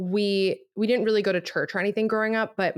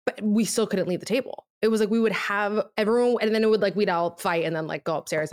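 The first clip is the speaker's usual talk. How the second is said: ordinary talk, nothing marked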